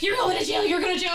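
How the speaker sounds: a hammed-up RA yelling; mock yell. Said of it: "The R A's yelling".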